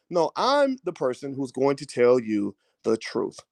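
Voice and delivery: usual speaking voice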